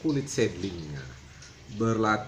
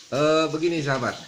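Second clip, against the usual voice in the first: ordinary talk, nothing marked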